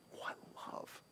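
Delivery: hushed, reverent whisper; awed whisper. Real, not acted. Preacher marveling at the love of Jesus, awed whisper. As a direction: whisper it in wonder.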